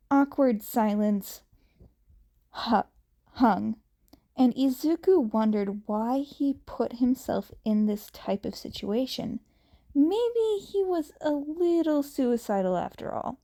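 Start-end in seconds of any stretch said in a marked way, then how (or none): none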